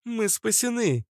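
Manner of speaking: very high cry without added volume; mock yell. A narrator's reading. Natasha cries that they are saved, restrained high yell. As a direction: exclaim high, holding back the volume. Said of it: "заорала Наташа".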